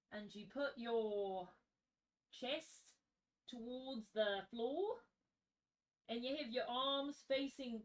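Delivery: practised Australian accent, kept quiet. Shown as a demonstration. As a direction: talk them through it in careful Aussie. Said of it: "doing a good Australian accent".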